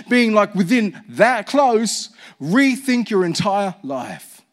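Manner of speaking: "rethink your entire life" slowed, each word pressed; slow emphatic preaching. Acted. Pastor urging the church to rethink everything, slow emphasis. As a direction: drive home "rethink". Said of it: "preaching at full force".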